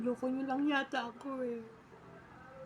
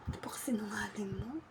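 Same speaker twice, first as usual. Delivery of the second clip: whisper; 4 semitones lower, 7 dB quieter than usual. Acted. Accusing someone of lying, quietly.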